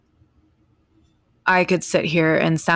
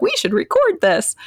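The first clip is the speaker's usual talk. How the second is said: much higher, bright burst of excitement; excited idea; 12 semitones higher than usual. Retold or imitated. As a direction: pitch it like a sudden great plan. Excited idea between new friends.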